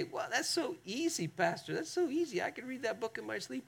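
light, high, dismissive put-on voice; mock congregant. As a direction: play the breezy church member brushing it off. Mimics a breezy congregant's objection.

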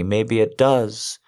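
ordinary talk

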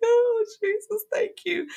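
high, slow gushing thank-you; overdone gratitude. Retold or imitated. Retelling thanking her pastor for the book, gushing high voice. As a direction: ham up being overwhelmed with thanks. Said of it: "I probably didn't say it like that".

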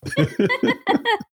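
squeaky laugh; high burst of laughter. Real, not acted. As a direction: let out a high giggle. GM laughs at the joke.

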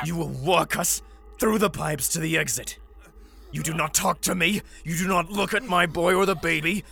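high, loud, commanding; a father laying down orders to his prisoner; angry threat. Acted. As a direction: lay down the law in anger.

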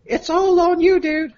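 high-pitched put-on girlfriend voice; hammed-up girly texting voice. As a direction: read it in a mock-girlish falsetto. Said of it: "a girly voice for this part".

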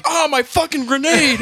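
put-on high, loud, slow drunken wail; drunk guy's wail. Guy wailing for his spilled drink, high and loud. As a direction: wail over the lost drink. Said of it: "he's like".

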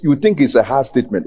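ordinary talk

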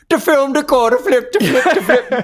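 high, loud mock Swedish gibberish; Swedish Chef. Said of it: "the Swedish Chef from the Muppets".

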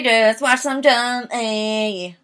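slow, put-on Australian accent; Australian accent, lightly done. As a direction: drawl it out in a mock Australian accent. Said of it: "my Australian accent is really good".

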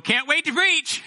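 sarcastic chirpy can't wait; mock eagerness. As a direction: overplay the enthusiasm sarcastically. Sarcastic imitation of eager preacher.

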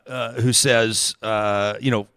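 normal talking voice

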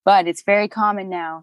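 usual speaking voice

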